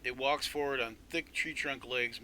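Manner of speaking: ordinary talk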